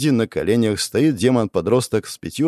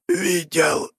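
raised, strangled last word; gasping for air; 6 semitones higher than usual. A narrator's reading. Hero suffocating under the demon, strangled gasp. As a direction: push it out while suffocating. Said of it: "Я задыхался".

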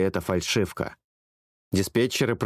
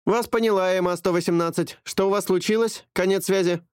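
much higher, crisp radio dispatcher; female dispatcher voice; 10 semitones higher than usual. A narrator's reading. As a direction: answer like an air traffic controller. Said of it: "женский голос ответил". (высокий голос диспетчера по рации)

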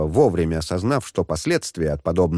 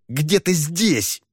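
irritated; 8 semitones higher, much slower than usual. Acted. Shen searches, irritated.

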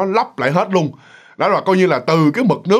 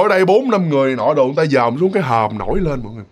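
normal talking voice

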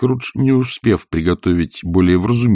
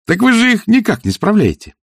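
high, louder protest; exclaiming; 4 dB louder than usual. A narrator's reading. Nephew objecting to his uncle, exclaiming. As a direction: protest in amazement. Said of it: "воскликнул племянник".

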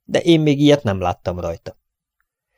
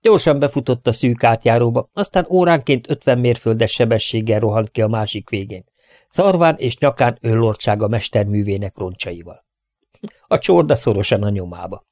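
usual speaking voice

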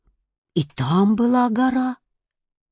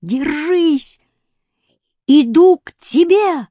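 calling out; 7 semitones higher, 4 dB louder, slower than usual. A narrator's reading. Boy calling up to cat.

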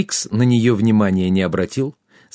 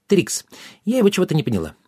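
high little fairy voice; puzzled fairy; 8 semitones higher, faster than usual. A narrator's reading. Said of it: "подала голос фея".

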